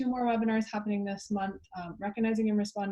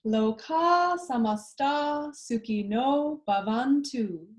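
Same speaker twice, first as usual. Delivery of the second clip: slow spoken line, plain speaking voice; spoken slowly; 3 semitones higher, slower than usual. Shown as a demonstration. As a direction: speak it through slowly, not sung. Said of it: "And then together".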